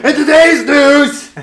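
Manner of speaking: high, drawn-out shouted news intro; super loud. Pretend. Host clowns into the microphone, super loud mock news shout. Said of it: "Just go all super loud".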